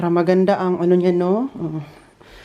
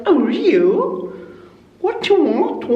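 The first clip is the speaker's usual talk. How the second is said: imitated English accent; 9 semitones higher, slower than usual. Vlogger showing how English guests sound, exaggerated mock British accent. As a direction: swoop up high in a playful Manchester accent. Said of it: "pakinggan yung accent lang".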